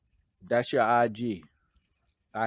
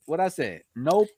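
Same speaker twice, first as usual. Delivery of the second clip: usual speaking voice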